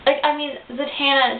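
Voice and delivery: usual speaking voice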